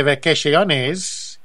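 vacaciones drawn out slowly, mock Spanish accent; slow exaggerated Spanish vacaciones; hammed-up Spanish. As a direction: stretch vacaciones like a jokey tourist. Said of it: "as they say".

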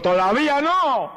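very high, slow, booming divine voice; voice of God. Retold or imitated. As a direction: speak as God, slow and solemn. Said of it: "oí la voz de Dios ... Me dijo". (voz de Dios, aguda y lenta)

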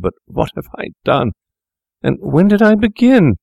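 high, rising desperate protest; anguished cry. Acted. Fettes cries out to Macfarlane in mounting high panic. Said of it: "he cried".

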